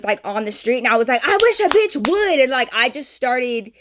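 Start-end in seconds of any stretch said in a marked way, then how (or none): 1.2-2.4 high-pitched, indignant challenge; mad dare. Retold or imitated. Sister out on the street daring the girls, mad. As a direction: retell the angry taunt with attitude. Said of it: "I got so fucking mad".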